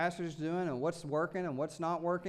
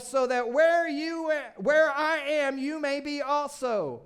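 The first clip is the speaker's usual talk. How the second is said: slow, loud, every word weighted; slow emphasis; 5 semitones higher, 5 dB louder, slower than usual. Acted. Preacher savouring Jesus' promise, slow and emphatic. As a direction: lean on each word slowly.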